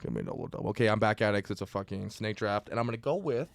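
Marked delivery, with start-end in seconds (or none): 0.0-0.7 ordering at Tim's. Shown as a demonstration. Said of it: "what do you say?".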